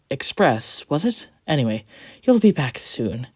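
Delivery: kind, slightly flustered reassurance; reassuring. A narrator's reading. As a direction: comfort her sweetly. Quincy reassuring coach.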